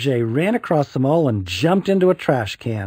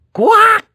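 shrill, loud duck-like squawk; high quack shout; 10 dB louder than usual. A narrator's reading. Boy kissed by his aunt, high quack squawk. Silly outburst.

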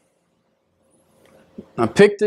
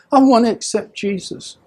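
higher, mildly imitated crying woman; retold tearful plea; 7 semitones higher than usual. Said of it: "she was crying".

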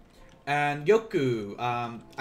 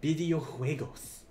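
slow, playful Spanish accent. Shown as a demonstration. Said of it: "My Spanish accent".

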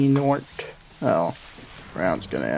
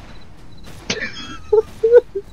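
high giggle. Real, not acted. Laugh at scare.

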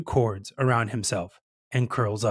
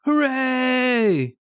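high, long hooray; triumphant cheer; 12 semitones higher, 4 dB louder than usual. A narrator's reading. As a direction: cheer it out with glee. Mask's sail works in story.

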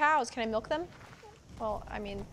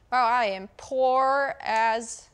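slow, loud and emphatic; loud exclamation; 11 dB louder, much slower than usual. Real, not acted. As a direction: belt it out, stretching each word. Exclaims at her empty purse.

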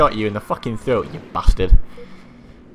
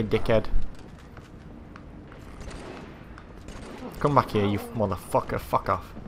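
pissed off; 6 dB quieter than usual. Real, not acted. Player cursing an enemy to come back, pissed off. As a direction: grumble curses under your breath, irritated. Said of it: "That was me getting pissed off quite easily".

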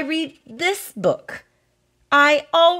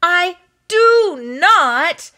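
"do not" slow, high and loud; emphatic refusal; 5 semitones higher, 5 dB louder, much slower than usual. Acted. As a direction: stretch "do not" out, each word its own blow. Emphatic refusal to read paragraphs.

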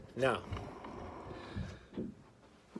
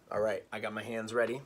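ordinary talk

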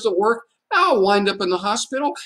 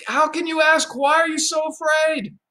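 indignant Andrew; 7 semitones higher than usual. Retold or imitated. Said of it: "he says".

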